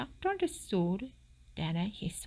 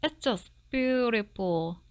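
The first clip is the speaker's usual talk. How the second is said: delighted fox; 4 semitones higher, 3 dB louder than usual. Acted. Fox admires gold tooth.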